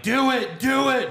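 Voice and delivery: high, goading mock voice; egging someone on. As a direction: urge them on in a high voice. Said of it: "And Rhea's like".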